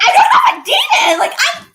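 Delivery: loud laughing shout, pitched high; a teen laughing and yelling at grandma's prayer; laughing protest. Retold or imitated. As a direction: shout it through laughter, mock offended.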